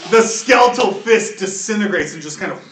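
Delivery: ordinary talk, nothing marked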